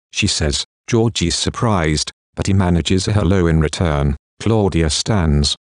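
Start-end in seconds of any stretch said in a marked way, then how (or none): none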